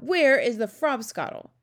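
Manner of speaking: crying out. A narrator's reading. Giant demanding his fizzy drink, crying out. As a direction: call out for it forcefully. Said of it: "the B F G cried out".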